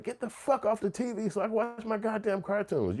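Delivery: high, soft whiny complaint; annoyed kid. Retold or imitated. Kid stuck with the telethon, high and whiny. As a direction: whine like a kid who wants his cartoons. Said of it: "you sitting back like".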